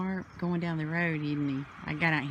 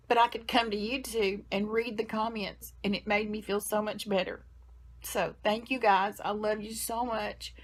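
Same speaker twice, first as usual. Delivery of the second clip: tearful thanks; 4 semitones higher than usual. Real, not acted. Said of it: "I wanna quit crying".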